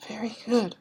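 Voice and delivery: put-on high whispered child's reply; boy, whispered. Frightened boy answering Trunchbull, high whisper. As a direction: breathe it out like a nervous boy. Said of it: "the boy said".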